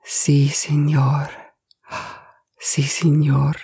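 slow whispered agreement; stunned servant whispering assent to his boss; whispered si senor. Acted. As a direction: breathe the words out in awe. Servant overwhelmed, barely speaking.

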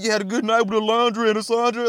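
quick, sly taunt; mock smug rival. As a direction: needle him fast and sly. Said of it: "he was like".

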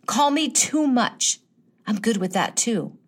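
ordinary talk

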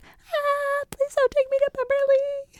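high-pitched squealed plea, acted out; mock panic. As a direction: squeal it like someone comically freaking out. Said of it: "freaking out".

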